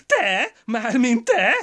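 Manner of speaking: high, loud incredulous shriek; wife shrieking at her husband's lie. A narrator's reading. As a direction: screech it in disbelief. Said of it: "visított Dusa".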